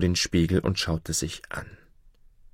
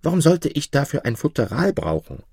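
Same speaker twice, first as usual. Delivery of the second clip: much higher, affronted question; indignant dwarf; 8 semitones higher than usual. A narrator's reading. Jakob questions the shoemaker about his nose, affronted. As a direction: sound baffled and offended. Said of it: "Jakob keeps questioning".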